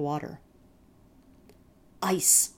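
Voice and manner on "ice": sharp hiss through clenched teeth; hissed through gritted teeth. Acted. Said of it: "hissed my brother through gritted teeth".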